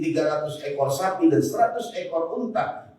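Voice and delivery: ordinary delivery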